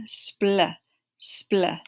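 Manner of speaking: ordinary delivery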